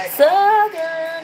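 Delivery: southern drawl. Shown as a demonstration. A Tennessee streamer showing off her Southern accent, drawled. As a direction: lean into the Southern twang on Southern. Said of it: "Little southern lady".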